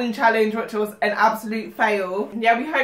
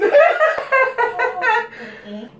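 squealing laugh in pain; high helpless laugh; 12 semitones higher than usual. Real, not acted. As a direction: laugh high through the burn.

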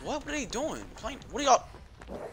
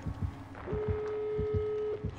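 sharp high frightened gasp; a real scared gasp at a noise; startled gasp; 9 dB quieter than usual. Real, not acted. Startled by something outside.

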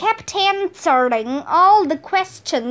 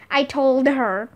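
plain reading voice

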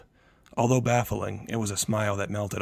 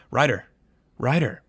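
raised calls of his name; calling out; 4 semitones higher, 7 dB louder than usual. A narrator's reading. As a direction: try to rouse him, louder. Mother calling unresponsive son.

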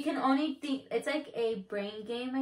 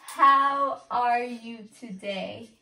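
half-speed and louder, each word stretched; slow exaggerated enunciation; 6 dB louder, much slower than usual. Real, not acted. Repeating for partner in loud headphones, slow and loud. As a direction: mouth every word big for a lip reader.

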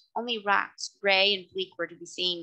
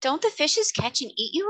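high-pitched curious question; raised, inquiring girl's voice; 7 semitones higher than usual. A narrator's reading. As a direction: ask it with childlike curiosity. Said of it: "inquired Trot curiously".

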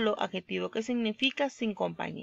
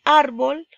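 "árbol" with a loud, high ÁR; stress on ÁR; 5 semitones higher, 9 dB louder than usual. Shown as a demonstration. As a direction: punch the first syllable of "árbol". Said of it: "que es ar".

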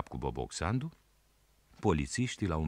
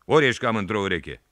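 annoyed policeman; 5 semitones higher, 7 dB louder than usual. A narrator's reading. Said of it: "a zis polițistul, mai supărat".

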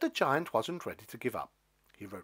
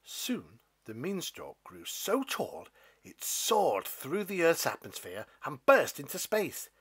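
usual speaking voice

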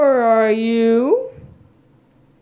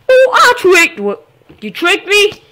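surprised; 8 semitones higher, 5 dB louder than usual. Real, not acted. Realizes he was tricked.

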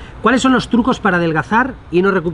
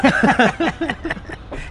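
giggle; 9 semitones higher than usual. Real, not acted. Laughing at double meaning.

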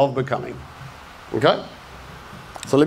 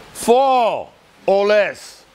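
slow, raised call to the room; drawn-out, higher-pitched roll call; 5 semitones higher, much slower than usual. Real, not acted. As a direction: stretch the number out for the crowd. Calling for a show of hands.

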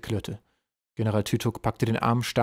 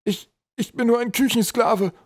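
high, slow stammering plea; trembling fear; 13 semitones higher, 6 dB louder than usual. A narrator's reading. Troll slave tries to escape the mission, trembling. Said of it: "Er begann zu zittern".

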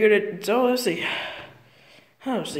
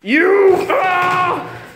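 mock attack shout; 8 semitones higher, 5 dB louder than usual. Character attacked in pretend play.

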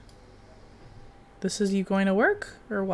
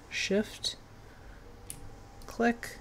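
whispered; 13 dB quieter than usual. Real, not acted. Gamer whispering the controls to herself while playing. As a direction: shift click breathed out under the breath. Reading game controls aloud.

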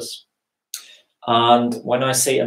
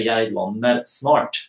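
"Jeg lander snart" slow, the s in snart kept clear; slow, separate; slower than usual. Shown as a demonstration. Teacher showing careful slow speech. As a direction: take "Jeg lander snart" slowly, words apart. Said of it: "if I want to speak slowly".

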